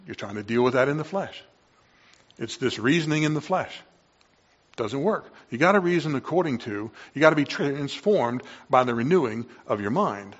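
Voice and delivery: ordinary talk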